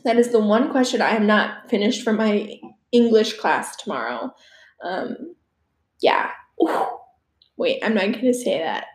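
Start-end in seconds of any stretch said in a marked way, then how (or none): none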